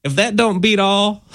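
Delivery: high-pitched southern exclamation; southern accent. Acted. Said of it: "Why is your voice getting southern sounding?".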